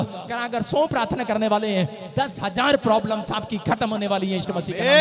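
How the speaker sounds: loud, driving sermon cadence; full-force preaching. Acted. Preacher promising the congregation, loud preaching. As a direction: proclaim it to the whole church.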